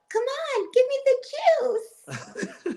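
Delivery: put-on very high, slow, airy girly voice. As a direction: mimic a breathy pop star. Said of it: "it's [name]'s dumb, airy voice".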